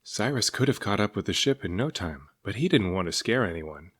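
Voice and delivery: plain reading voice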